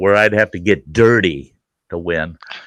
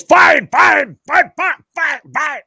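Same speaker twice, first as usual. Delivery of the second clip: much higher, loud, fading as it goes; yelled surrender; 4 dB louder, slower than usual. Retold or imitated. Son giving up under his dad, high yelled surrender. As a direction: shout it out giving up, then let it drop. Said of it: "And he goes".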